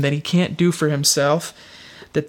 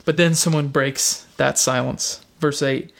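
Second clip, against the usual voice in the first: ordinary delivery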